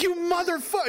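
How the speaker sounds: high, disbelieving curse; mock outrage. Soldier retelling his betrayed reaction, high and disbelieving.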